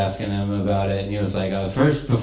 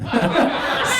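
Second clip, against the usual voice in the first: airy laugh up high; high breathy laugh; 14 semitones higher than usual. Real, not acted. Laughs at own joke.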